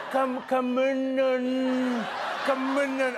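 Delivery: hammed-up struggle to get a word out; mock exertion. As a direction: imitate Trump heaving out a hard word. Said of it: "looked like the most exercise he's gotten".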